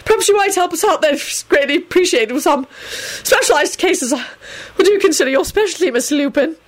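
high, panicky minister babbling; Fudge. Acted. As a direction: babble nervously in a high, halting voice. Fudge panics before the werewolf.

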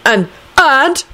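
high, halting interruption; puzzled, irritated. A narrator's reading. Neville interrupting the bickering pair, puzzled irritation. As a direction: break in with irritation. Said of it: "puzzled and irritated voice".

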